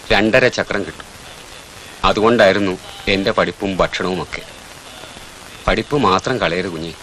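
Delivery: put-on telling the boy of her own poverty, tenderly; tender confiding. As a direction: quietly share your own hard past.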